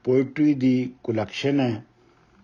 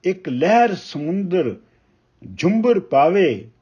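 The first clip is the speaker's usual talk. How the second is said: measured, weighty reading of a Punjabi poem; ਕਵਿਤਾ ਪਾਠ ਦੀ ਠਹਿਰਾਵ ਵਾਲੀ ਅਵਾਜ਼; poem recitation; 2 semitones higher, 3 dB louder than usual. Acted. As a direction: recite each line slowly, letting pauses breathe. Poet recites poem Lukan Miti.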